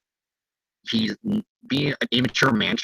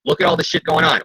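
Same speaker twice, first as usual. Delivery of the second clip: ordinary talk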